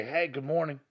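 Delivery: sunny greeting with a lift; cheery good morning. Shown as a demonstration. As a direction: sell a happy hello. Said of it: "it's always better to say".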